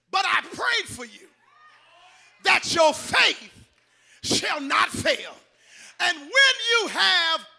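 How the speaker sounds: full-force preaching. Real, not acted. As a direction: roar the promise out to the congregation.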